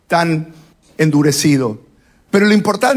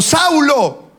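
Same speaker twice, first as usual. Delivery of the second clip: lightly voiced divine call, higher pitch; God calling; 7 semitones higher than usual. God calls Saulo by name, lightly acted calling. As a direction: call the name out from above, mildly.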